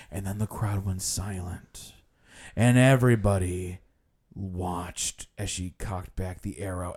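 low, hushed suspense storytelling; dramatized tour guide. Retold or imitated. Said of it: "he's like".

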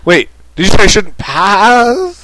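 hammed-up confused Balrog. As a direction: play the baffled creature. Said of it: "the Balrog's like".

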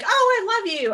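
high, loud, sing-song "I love you"; gushing mock affection. Teasing a friend's mom: hammed-up high gushing. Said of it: "Even if she wasn't like".

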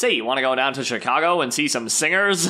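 high, loud vintage radio announcer; old-timey announcer. Acted. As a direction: ham up a 1950s radio pitchman. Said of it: "'cause I'm an old-timey announcer".

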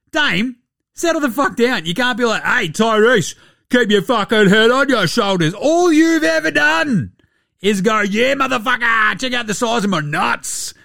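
mock indignant rant. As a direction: rant at a player in mock outrage. Mock telling-off of Dame with taunts.

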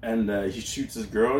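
ordinary talk

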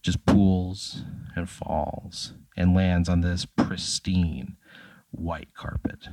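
slow, hushed noir narration, sinking to a whisper; dramatic slow narration. A narrator's reading. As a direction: linger on every word as the drop falls. GM's dramatic noir description.

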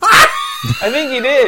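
high, slow, drawn-out shout; mock shout. Real, not acted. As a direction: bellow it out, hamming it up. Playful insistence, shouted high.

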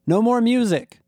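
high, exasperated call; yell. A narrator's reading. Narrator orders Rob to stop the song, yelled. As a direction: call it out urgently, pitch well up. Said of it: "I yelled".

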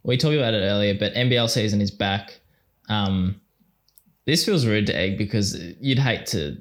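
ordinary talk, nothing marked

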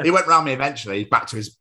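usual speaking voice

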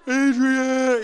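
loud, drawn-out movie-hero yell; Rocky. Retold or imitated. Boxer calling his love after a knockout, mock bellow. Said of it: "I saw Rocky going".

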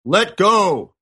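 lightly put-on voice of God; mock-divine command, resounding; deep resounding voice. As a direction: play God booming down from the clouds. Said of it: "a deep voice resounded".

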